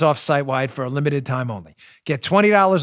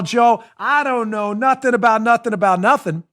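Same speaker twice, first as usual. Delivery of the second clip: Sergeant Schultz; 6 semitones higher than usual. Retold or imitated. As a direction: play dumb like Sergeant Schultz. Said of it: "Sergeant Schultz style".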